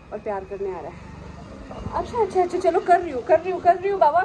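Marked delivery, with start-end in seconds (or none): 1.7-4.2 quick, high and louder, laughing give-in; giving in. Real, not acted. Owner giving in to her excited dog, quick high voice. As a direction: hurry to reassure an eager dog. Dog demanding cuddles, she gives in.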